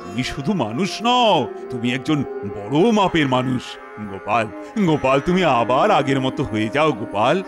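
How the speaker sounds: low-pitched, gentle royal coaxing; soothing apology. Acted. King begging Gopal's forgiveness, soothing. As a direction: coax him back kindly in a deep voice.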